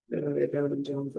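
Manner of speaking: ordinary talk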